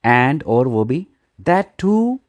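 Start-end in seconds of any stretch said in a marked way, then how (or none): 1.4-2.2 mock scolding. Lady scolds Ravi.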